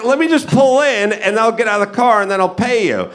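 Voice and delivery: high-pitched, mock-exasperated plea; exasperated reasoning. Driver bargaining with the lot attendant, exasperated. Retold pleading with parking attendant.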